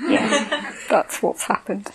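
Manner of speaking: quiet laugh through the words; laughing hare. Acted. Hare laughs as he admits to the lion, laughing softly. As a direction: giggle out the admission.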